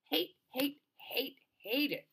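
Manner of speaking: high, each hate separated and drawn out; frustrated. Acted. Delphi venting about the cottage, frustrated. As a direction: vent it word by word. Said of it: "Delphi says, frustrated".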